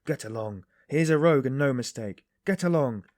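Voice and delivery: loud, rough shout; angry porter. A narrator's reading. Big porter throwing the stranger out, loud and angry. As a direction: drive him off with your voice.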